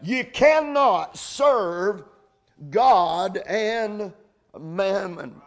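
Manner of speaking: slow, "cannot" hammered home; emphatic, dogmatic. Shown as a demonstration. Preacher warning his church, slow dogmatic stress. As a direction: spell it out, no room for doubt. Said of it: "that's emphatic, dogmatic".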